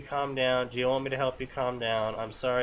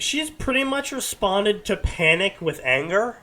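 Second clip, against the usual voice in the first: ordinary talk, nothing marked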